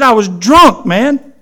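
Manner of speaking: punchy mock-incredulous quote; amazed retold exclamation. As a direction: act out his astonished confession. Retelling an amazed man's words.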